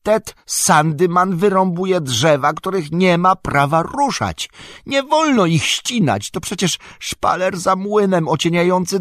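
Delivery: high, loud outraged protest; indignant shouting. A narrator's reading. Sam watches Ted felling trees back home, indignant shouting. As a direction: cry out against the outrage, voice pitched right up. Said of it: "krzyknął oburzony".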